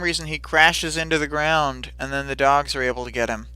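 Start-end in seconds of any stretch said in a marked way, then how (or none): none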